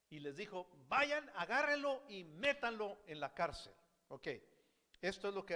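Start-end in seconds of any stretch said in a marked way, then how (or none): 0.9-3.7 angry king's command. Retold or imitated. Angry king orders servant jailed.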